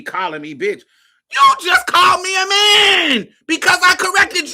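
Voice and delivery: yelling, high and loud, half laughing; furious shout. Retold or imitated. Retelling how she cussed out the ex, furious shouting. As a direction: tear into him at full volume. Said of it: "I was like".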